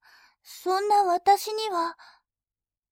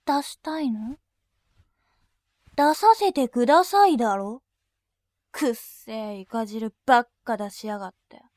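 lower, harsher voice spitting insults, some bursts loud; berating insults; 3 semitones lower, 3 dB louder than usual. Acted. Attendant berating her customer, contemptuous barking insults. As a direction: drop the politeness and tear into him. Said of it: "罵倒しながら".

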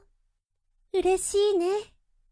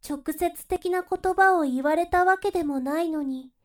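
normal talking voice

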